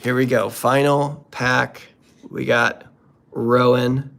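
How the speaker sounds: normal talking voice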